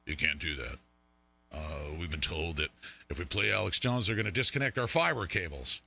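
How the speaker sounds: very low, soft official voice; deep put-on voice of a complaining caller; scolding phone caller. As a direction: drop low like a hushed bureaucrat on the phone. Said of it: "pick up the phone and say".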